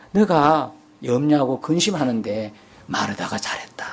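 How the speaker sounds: hushed, breathy aside; whispered. Pretend. Imagined Jesus praising Martha, whispered. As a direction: whisper it like a secret.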